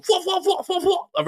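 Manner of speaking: very high rapid stammer; hammed-up squawked repetition of a meme; imitated random echolalia. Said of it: "Caris will just randomly go".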